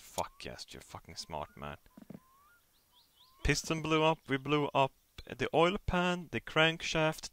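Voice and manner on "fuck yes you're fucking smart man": much lower and softer, under the breath; muttered self-praise. Real, not acted. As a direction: mutter it to yourself, pleased.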